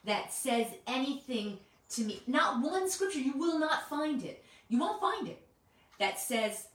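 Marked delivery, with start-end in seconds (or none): 2.3-6.7 much higher and louder, insistent; emphatic preaching. Real, not acted. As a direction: hammer home that it isn't there.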